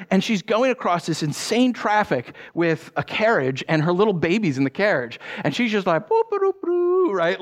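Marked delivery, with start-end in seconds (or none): 6.1-7.1 high, singsong boops; carefree mock humming. Imitating mother strolling through insane traffic, carefree high boops. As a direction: play a mother tootling along obliviously. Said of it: "not a care in the world".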